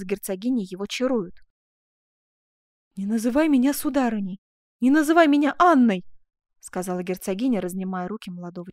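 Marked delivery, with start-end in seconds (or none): 3.0-6.0 building to a loud, high cry; impassioned, rising insistence; passionate protest. Acted. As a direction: forbid it, louder on the second plea. Duchess protests being called madam.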